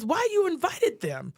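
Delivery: high, loud, outraged complaint; indignant. Retold or imitated. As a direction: rant at them, high and loud. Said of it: "And she tells C P S".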